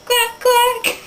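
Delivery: high, sharp duck quacks; duck quacking. Pretend. Playing animal noises as a duck, quacking. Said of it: "I'm a duck".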